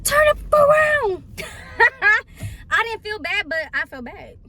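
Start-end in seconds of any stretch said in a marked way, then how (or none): 0.0-1.2 very high, loud, drawn-out scream; full-on yell. Retold or imitated. Passenger screaming at driver while smacking dashboard, furious yell. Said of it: "I'm all up in this". 1.3-2.6 high, loud burst of laughter; laughing. Real, not acted. Storyteller amused by her own screaming, bursting out laughing.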